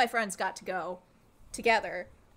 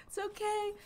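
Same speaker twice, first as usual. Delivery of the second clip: mock soothing in a much higher voice; high-pitched reassurance; 11 semitones higher than usual. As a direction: reassure panicking strangers in a high little voice. Repeats own reassurance to strangers.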